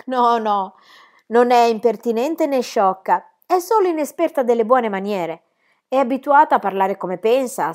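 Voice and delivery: smooth things over eagerly; pleading aunt. Acted.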